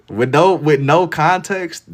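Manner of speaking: high, loud and slow; emphatic amusement. Real, not acted. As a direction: stretch it out, amazed. Amused, emphasizing how it sounded.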